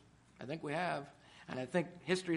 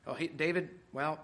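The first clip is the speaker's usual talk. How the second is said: ordinary delivery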